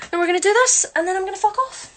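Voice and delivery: cheeky tease. Pretend. Cheeky joking about leaving.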